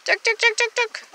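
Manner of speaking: high tuk tuk poultry call; calling chickens. Real, not acted. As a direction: cluck the birds over, high. Mom calls birds with clicks.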